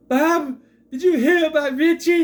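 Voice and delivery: high, loud melodramatic cry; soap opera acting. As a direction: ham it up like an overwrought soap actress. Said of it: "What is this soap opera acting?".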